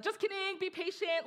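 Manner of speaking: high, playful mock reassurance; teasing letdown. Preacher voicing the delayed promise of peace, playfully teasing. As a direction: take it back in a high, teasing voice.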